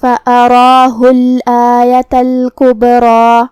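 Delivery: slow chant of فَأَرَاهُ الْآيَةَ الْكُبْرَى with full heavy r and held vowels; heavy ra, long alif. Shown as a demonstration. Teacher models the rules, فَأَرَاهُ الْآيَةَ الْكُبْرَى chanted with heavy r. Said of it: "را کو اور مد الف کو دونوں کو بھاری آواز میں ادا".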